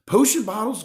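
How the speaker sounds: plain reading voice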